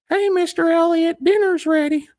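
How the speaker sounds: very high, chirpy call; sweet caller. Pretend. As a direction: sing out a cheerful dinner call. Someone calling Sam Elliott to dinner.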